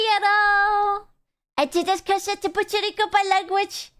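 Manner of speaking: high, indignant call-out; mock outrage. Scolding a friend for butchering her language, high and indignant. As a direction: bark the name then complain.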